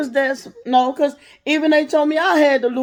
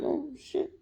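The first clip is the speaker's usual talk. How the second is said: scared whisper; 4 semitones higher, 15 dB quieter than usual. Retold or imitated. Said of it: "I'm like".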